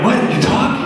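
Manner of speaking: high squeak of surprise; hammed-up astonishment; shocked disbelief. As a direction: react to a talking deer. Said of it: "I'm like".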